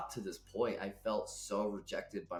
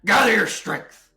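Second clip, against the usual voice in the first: loud, high wolf shout; frustrated wolf; 10 semitones higher, 14 dB louder than usual. Acted. Wolf rallying the snared creature, fierce loud urging. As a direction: command it at full force, growling. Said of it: "The wolf growls in frustration".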